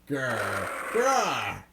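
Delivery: a low growl from the throat; low tiger growl. Acted. The circus tiger stalking the lawyer, low growl. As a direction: growl like a big cat.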